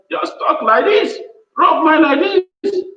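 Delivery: high-pitched, drawn-out demonstrating voice; casual talk demo. Shown as a demonstration. Coach showing associates how to chat without business jargon, high and drawn out. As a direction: pitch it high and stretch the last phrase. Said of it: "You just do it casual today".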